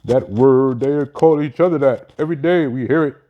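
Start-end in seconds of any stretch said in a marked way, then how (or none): none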